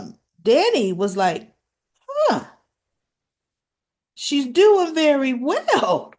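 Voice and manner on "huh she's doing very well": high-pitched, slow, drawn-out amazement; pleasantly impressed. Retold or imitated. Mother watching her daughter's shoot go well, impressed surprise. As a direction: let the surprise lift the voice way up. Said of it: "She was actually impressed".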